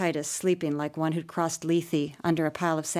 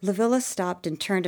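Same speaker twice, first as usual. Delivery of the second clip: plain reading voice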